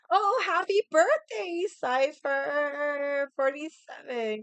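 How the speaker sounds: high, slow, delighted hello to a viewer; excited birthday greeting. Real, not acted. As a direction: sing out the birthday wish, drawn out and high.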